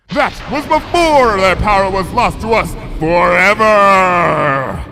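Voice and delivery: loud, high, slow thunderous declaration; booming royal voice. Acted. Luna's grief booming out with lightning, thunderous. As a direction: boom it out like a storm. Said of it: "Watch it".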